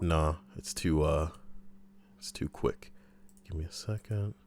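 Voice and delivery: ordinary talk, nothing marked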